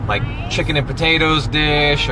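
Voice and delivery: normal talking voice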